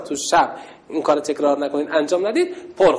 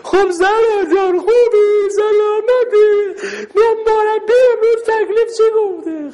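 high, louder drawn-out mocking chatter to a friend; ugly way of talking; 6 dB louder, slower than usual. Shown as a demonstration. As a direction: show the kids the ugly way to talk to a friend, much higher and louder. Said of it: "زشته اینجور حرف بزنیم". (نمایش حرف زدن زشت با دوست، زیر و بلند و کشیده)

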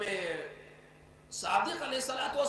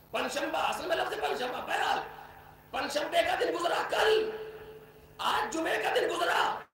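raised, insistent sermon voice; forceful preaching; 6 semitones higher, 3 dB louder than usual. Acted. Preacher warning his listeners about wasted days, forceful. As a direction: build it up to the warning.

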